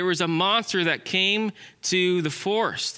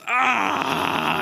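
acted cry of exasperation; mad cry; 3 semitones higher than usual. Squirrel worrying about paying bills, mad cry. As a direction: let out a stressed, angry "ah". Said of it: "he started getting mad".

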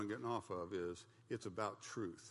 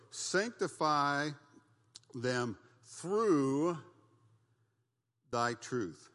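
slow, solemn reading of the verse; scripture read slowly; 3 semitones higher, 6 dB louder, much slower than usual. Acted. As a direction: draw out each word like holy writ.

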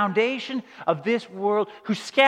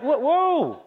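higher, rising alarm; alarmed whoa; 5 semitones higher, much slower than usual. Retold or imitated. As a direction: stop him in shock. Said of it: "And they're like".

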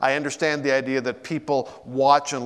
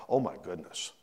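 hushed awe; 7 semitones lower, 4 dB quieter, much slower than usual. Retold or imitated. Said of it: "go like".

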